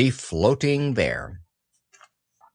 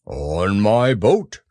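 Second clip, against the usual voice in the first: slow, proud bear voice; 4 dB louder, much slower than usual. A narrator's reading. Pooh tells Christopher Robin how he came, proud. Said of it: "said Pooh proudly".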